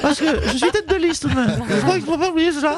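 Nathalie Loiseau impression. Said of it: "Bonjour Nathalie Loiseau".

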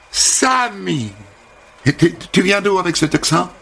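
high, bubbly American welcome; chirpy American hairdresser. Acted. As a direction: greet him like an eager stylist. Holly répète son nom, curieuse. (coiffeuse américaine enjouée, voix haute)

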